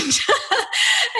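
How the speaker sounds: a sudden high squealing giggle; high laugh. Real, not acted. Remembering her rough butcher-shop self, a high squealing laugh. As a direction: burst into a high, helpless giggle.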